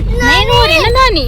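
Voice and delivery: high, loud, choking on tears; begging her not to cry through sobs; tearful plea. Real, not acted. Daughter to crying mother at farewell, tearful high plea.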